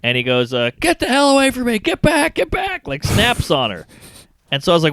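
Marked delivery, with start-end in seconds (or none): 0.8-2.9 put-on much higher snapping old-man voice; high-pitched mock old man yelling; angry old man. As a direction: play a panicky old man barking to back off.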